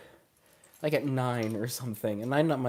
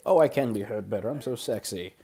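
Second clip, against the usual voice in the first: ordinary talk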